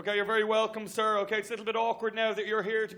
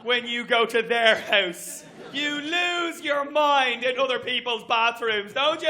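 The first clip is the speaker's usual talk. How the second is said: ordinary talk, nothing marked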